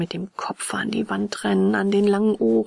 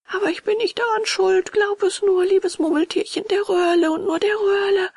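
very high, louder, whining voice; protesting puppet; 12 semitones higher, 4 dB louder than usual. Acted. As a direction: wail that it is not your fault. Puppet protests his innocence. (weinerlich protestierender Hampelmann, sehr hoch)

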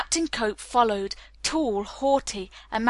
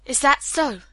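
higher, mildly annoyed challenge; mild growled retort; 5 semitones higher, 4 dB louder than usual. A narrator's reading. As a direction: push back with a touch of irritation. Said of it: "growled Hallett".

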